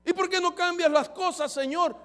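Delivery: reclamo imitado a Dios, más agudo y fuerte; queja quejumbrosa. Retold or imitated. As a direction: imita a alguien que se queja ante Dios. (creyente desanimado reclamándole a Dios, quejumbroso)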